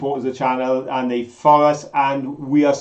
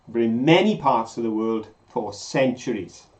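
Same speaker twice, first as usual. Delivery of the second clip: ordinary talk, nothing marked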